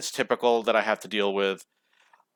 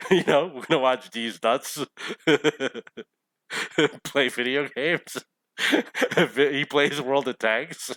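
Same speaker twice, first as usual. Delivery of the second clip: laughing through words; 5 semitones higher than usual. Real, not acted. Laughing at the channel name.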